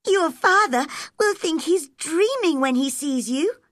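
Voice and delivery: put-on high-pitched, excited girl; high, lively child character; Mary. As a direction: voice an amazed young girl, pitched well up. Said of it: "cried Mary".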